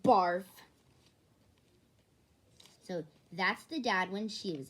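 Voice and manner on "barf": retching barf. Acted. Mock barf sound.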